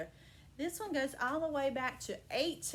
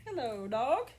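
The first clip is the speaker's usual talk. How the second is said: donkey greeting; 5 semitones lower than usual. Acted. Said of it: "That's what he said".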